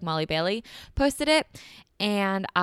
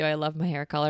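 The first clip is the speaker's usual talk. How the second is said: ordinary delivery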